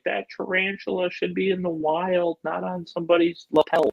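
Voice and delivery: lightly voiced objector, pitched well up; high-pitched mock indignation; mock complainer. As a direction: imitate a scandalized complainer in a high voice. Imitating people who complain.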